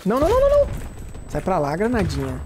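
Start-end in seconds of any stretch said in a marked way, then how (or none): 0.1-0.7 high panicked no-no-no; frantic loud protest; raging gamer. Real, not acted. Danger in game.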